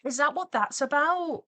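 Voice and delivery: high, loud dismayed question; shocked realization. Real, not acted. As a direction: cry out in disbelief. Shocked realization about picture.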